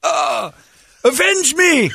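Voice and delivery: high dying cry, very hammy; overacted death scene. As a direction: shriek it like a dying hero. Mock death of last soldier.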